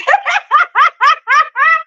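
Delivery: high, delighted laugh; high burst of laughter. Real, not acted. Realizing it's only Thursday, a high relieved laugh.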